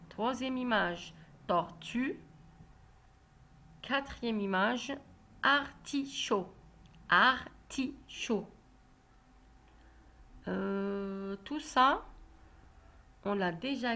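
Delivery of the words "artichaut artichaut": "artichaut" slowly split into ar, ti, chaut; syllable by syllable. Shown as a demonstration. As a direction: sound out "artichaut" slowly, a pause after each syllable. Teacher sounds out word for pupils. («artichaut» lentement, syllabe par syllabe)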